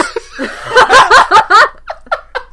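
loud, high helpless laugh; real loud laughing fit; burst of laughter. Real, not acted. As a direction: crack up completely.

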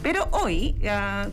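usual speaking voice

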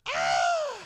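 high mock scream of a scared toddler; imitating toddler's scream. Father imitating his toddler daughter's scared scream, high mock squeal. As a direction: squeal like a frightened little girl. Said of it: "she screams".

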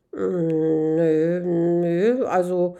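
sheepish, evasive stalling; reluctant. Acted. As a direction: drag out each word while searching for an excuse. Man reluctantly admits it isn't necessary.

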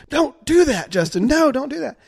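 high, loud scolding aunt; alarmed aunt. Retold or imitated. Aunt telling the injured boy to stop flopping his arm, high and alarmed. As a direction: snap it at him high and sharp, stopping him. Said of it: "she's like".

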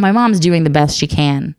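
normal talking voice

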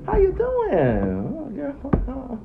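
high, slow fake-sweet greeting; fake friendly voice. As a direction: gush it in a high, sugary voice. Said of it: "She went".

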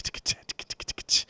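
voiceless tongue-and-teeth ticking; imitated drum ticks. Shown as a demonstration. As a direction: mimic a steady drum tick without voice. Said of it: "the drum's just in that tick".

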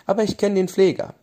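put-on a girl piping up with an idea; pitched high, eager to help. Leonie's high bright line.

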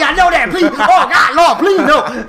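panicked begging. Retold or imitated. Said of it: "you wide awake".

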